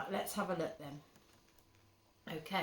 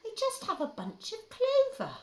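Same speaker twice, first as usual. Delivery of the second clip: timid rabbit; 7 dB louder than usual. Acted. Parent voicing the rabbit answering the rat, squeaky high. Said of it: "the rabbit replied".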